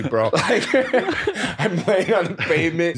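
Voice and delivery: words broken by real laughter; laughing. Real, not acted. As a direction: laugh through the telling. Laughing at memory.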